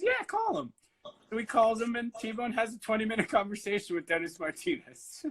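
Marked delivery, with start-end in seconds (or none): 0.0-0.7 much higher, eager push; eager retold command. T-Bone urging the stranger to phone Martinez, eager and high. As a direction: pitch it way up like you can't wait.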